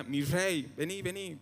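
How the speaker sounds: a hint of a casual modern plea; lightly voiced, breezy "mi rey, vení"; updated casual version. Preacher mimicking a modern-day plea to Jesus, lightly casual. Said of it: "O versión actualizada".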